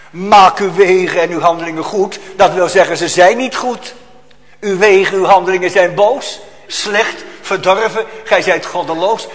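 loud, high accusing proclamation; preacher thunders the verdict at the congregation; fierce preaching. Acted. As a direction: hammer the charge home. Preacher proclaims the prophet's charge.

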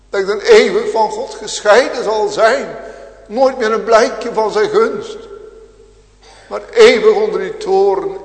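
full-force sermon voice; fiery preaching. Acted. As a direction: thunder it at the congregation.

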